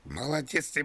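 ordinary delivery

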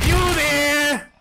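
high, loud call into the dark. Real, not acted. Searching player calling loudly for a teammate. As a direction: shout to find someone.

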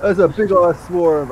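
loud, high alarmed call; alarmed shout. Real, not acted. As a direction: shout the warning, startled. Zombie horde spotted, alarmed.

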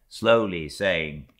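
ordinary delivery

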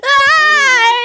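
high, loud scream; sudden high shriek; startled scream. Real, not acted. Startled by find in game.

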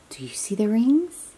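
ordinary talk, nothing marked

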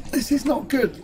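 high-pitched worry; rising alarm. Real, not acted. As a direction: pitch jumps up in real concern. Repeats it as trouble grows.

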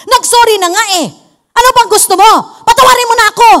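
fast, pushy demand for forgiveness; bold demanding. Acted. Person asking forgiveness as if owed it, bold. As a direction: demand pardon indignantly, pitch climbing. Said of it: "ang tapang natin".

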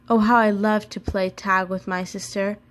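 usual speaking voice

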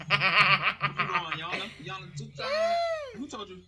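shouting through laughter; yelling while cracking up; laughing shout. Real, not acted. Friend laughing and shouting at call.

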